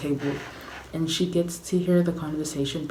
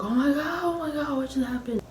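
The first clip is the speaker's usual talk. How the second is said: mock panicked screaming; 11 semitones higher, faster than usual. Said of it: "people are screaming, like".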